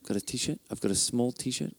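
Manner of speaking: hushed unvoiced whisper aside. Real, not acted. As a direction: whisper it off-mic, just breath. Quiet aside during the sermon.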